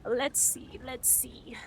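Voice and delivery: soft whispered musing. Real, not acted. Planning the next move in game, whispering. Sneaking, thinking about the play.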